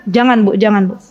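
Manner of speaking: ordinary talk, nothing marked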